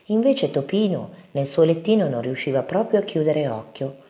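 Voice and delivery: usual speaking voice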